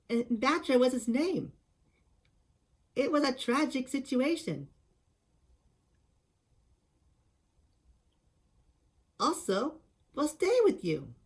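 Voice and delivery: Cosmos voice impression. Showing how to do the Cosmos from Mothra, high and airy. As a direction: talk like tiny gentle fairies. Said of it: "your Cosmos voice impression".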